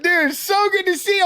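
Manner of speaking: high-pitched retold reunion joy; delighted greeting. Acts out happy reunion.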